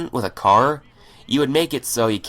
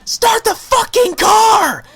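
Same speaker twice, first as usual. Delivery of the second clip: much higher, louder, slow furious scream; finally yelling; 6 dB louder, much slower than usual. Retold or imitated. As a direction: hit "fucking" hard and scream it out. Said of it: "And then I finally yell".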